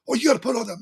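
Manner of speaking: high, shouted mock nagging; mocking mask nag. Caller fed up with people nagging him about masks, loud mock. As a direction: throw it out loud like a fed-up parody. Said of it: "sick and tired of hearing people go".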